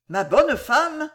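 high, slow coaxing address; insinuating. A narrator's reading. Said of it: "faisant usage de sa voix la plus insinuante". (voix insinuante, haute et traînante)

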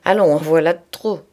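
loud, brusque order to stop; brutal voice. A narrator's reading. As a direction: cut the quarrel short forcefully. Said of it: "dit-il enfin d'une voix brutale".

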